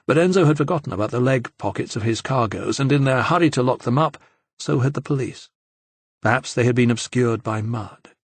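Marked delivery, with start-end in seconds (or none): none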